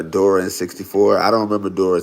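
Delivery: normal talking voice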